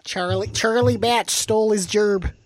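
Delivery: mock Pittsburgh accent. Co-host riffing on how Pittsburghers talk, mock Pittsburgh accent. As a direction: do a comic Pittsburgh local. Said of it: "people who actually say gerbs".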